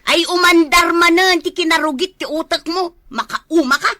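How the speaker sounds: loud, high exasperated shout; angry shout. Acted. Wife shouts at her husband's dirty mind, loud and angry. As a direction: yell it at him, disgusted.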